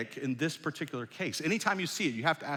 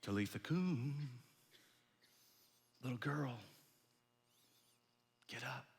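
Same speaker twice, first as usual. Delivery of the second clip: tender call; 3 semitones lower, 12 dB quieter than usual. Acted. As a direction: wake her as gently as a mother would. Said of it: "and he says to her".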